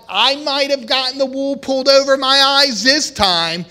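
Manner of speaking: acted resolute vow; defiant vow. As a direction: swear it won't happen again. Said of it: "said in your heart".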